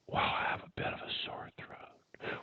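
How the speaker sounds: hushed whisper, explaining. Acted. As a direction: whisper an excuse gently. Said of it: "Why are you whispering?".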